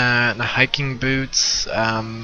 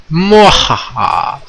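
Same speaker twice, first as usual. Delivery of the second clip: slow, louder villain laugh rising at end; hammed-up evil laugh; 3 semitones higher, 4 dB louder, much slower than usual. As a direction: stretch out a cartoon villain cackle. Repeats it more theatrically.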